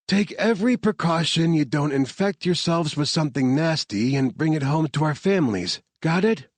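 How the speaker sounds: firm briefing, a hint of urgency; lightly voiced team leader. A narrator's reading. Laney warning the team before breaching the lab, firm. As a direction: commander laying out the stakes, lightly voiced.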